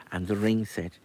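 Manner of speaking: usual speaking voice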